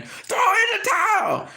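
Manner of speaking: very high, slow squealed order; mocking shrill yell. Andre Dirrell shouting at the corner, mocking shrill yell. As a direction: ham up a screeching bystander.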